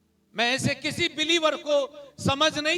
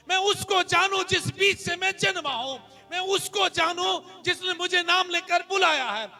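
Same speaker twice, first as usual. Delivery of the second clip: raised, driving sermon voice; impassioned preaching; 4 semitones higher, 6 dB louder than usual. Real, not acted. Pastor declaring whom he must know, impassioned preaching. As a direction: build insistent repeated declarations.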